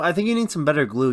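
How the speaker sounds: ordinary talk, nothing marked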